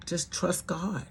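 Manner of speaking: soft, unhurried soothing words; calm reassurance. Retold or imitated. Brother-in-law soothing his angry sister-in-law, softly calm. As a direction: gently talk her down, no yelling. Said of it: "Orlando would just calmly".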